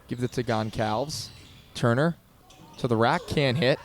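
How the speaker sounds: ordinary talk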